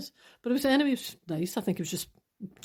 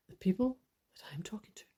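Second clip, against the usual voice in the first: whispered aside; 7 dB quieter than usual. Real, not acted. A hushed aside to podcast listeners, whispered. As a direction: confide it in a whisper.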